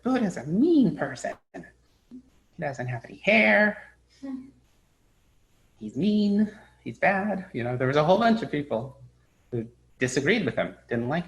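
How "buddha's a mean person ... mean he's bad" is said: high, childish voicing of the Buddha's critics; mocking critics. Teacher mimics the critics of the Buddha, higher and childish. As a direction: sound like petty, sulky accusers.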